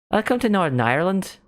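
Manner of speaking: high, swooping Northern Irish lilt; Northern Irish accent. Pretend. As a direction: put on a stronger Northern Irish accent, rising at the end. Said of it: "I wish I had a strong accent".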